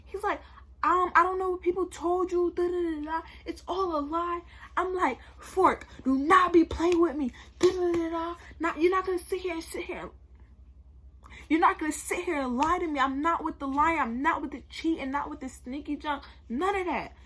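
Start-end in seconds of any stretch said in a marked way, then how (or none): 0.8-4.4 loud, high-pitched protest; defensive denial. Retold or imitated. Accused boyfriend answering his angry girlfriend, defensive. 5.4-17.1 loud, high angry rant; going off. Retold or imitated. Girlfriend fed up with her boyfriend's lying, going off. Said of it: "So I'm going off on him".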